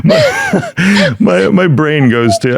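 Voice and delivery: high, giggling, slowed words; laughing. Real, not acted. Host still laughing at the joke, giggling. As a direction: still giggling while trying to talk.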